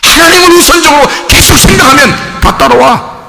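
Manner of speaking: high, loud preaching cry; fiery preaching. Acted. Pastor preaching to the congregation, fiery and loud. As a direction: preach it out with force.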